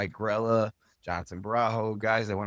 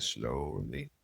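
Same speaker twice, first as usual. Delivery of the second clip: slow, low, stretched-out slowly; a slow, low, weary build; drawn out slowly; 3 semitones lower, much slower than usual. Shown as a demonstration. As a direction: drag each slowly out to show the grind. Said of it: "slowly, slowly, slowly".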